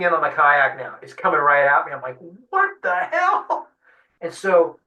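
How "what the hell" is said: a stretched, very high alarmed cry; alarmed what the hell. Retold or imitated. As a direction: squeal it slowly as the shark charges. Shark charging the kayak.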